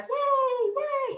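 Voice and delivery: high squealy yay; imitating guests cheering. Guests at the party cheering the hostess, high mock squeal. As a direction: play the crowd cheering for her. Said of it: "the people are like".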